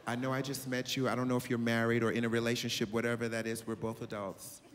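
calm and in total control; grown woman voice. Retold or imitated. Voicing the older woman.

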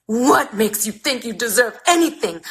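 furious outburst. Acted. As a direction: let the anger burst out on anything. Mother's fury erupts.

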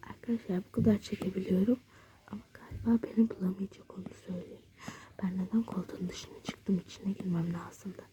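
quick hushed whisper; fast whisper. Real, not acted. Girl hiding, whispering quickly to viewers. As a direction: rush it out in a low whisper.

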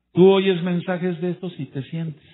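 loud, high rebuke to the congregation; fiery preaching. Acted. Preacher scolds the offended listener, loud and high.

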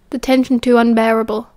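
ordinary delivery, nothing marked

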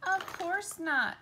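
high, loud confident dismissal; high, loud "of course not"; breezy brush-off. Acted. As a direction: wave off the danger. Ariel brushing off fear.

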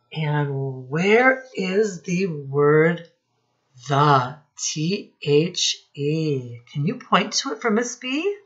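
ordinary delivery

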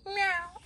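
very high, tiny cat meow; Gary the snail answering with a very high meow. Acted. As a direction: squeak out a little meow. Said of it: "And here's Gary".

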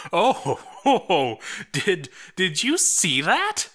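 high, loud burst of laughter, can't believe it; cracking up at what just happened; laughing in disbelief. Real, not acted. Replay shocks the commentator.

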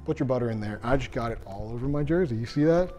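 ordinary delivery

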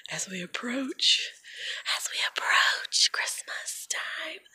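slow, hushed whisper; playful whisper. Pretend. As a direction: whisper it slowly, drawing out the anticipation. Hushed, drawn-out build-up to Christmas.

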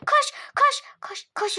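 high, crying-out urge to run; urgent urging. Acted. Mother urges Üçlü to run for the cake, high and urgent. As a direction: push them to run, tearful.

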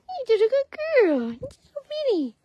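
very high cooing praise. Real, not acted. Owner praising her mini donkey, very high baby talk. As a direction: coo at the tiny donkey.

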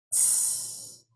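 breathy unvoiced tss like a brushed cymbal; a soft cymbal hiss; soft aspirated hiss. Shown as a demonstration. Poet demonstrating the aspirated cymbal sound, soft hiss. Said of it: "Softly aspirate".

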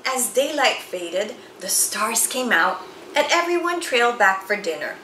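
usual speaking voice

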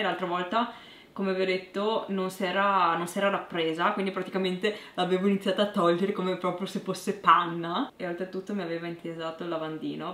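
lips coated in mask; talking while peeling mask; speaking with face mask on. Real, not acted.

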